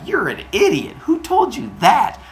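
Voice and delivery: high, loud mocking schoolkid voice; jeering kid. Kids mock the boy.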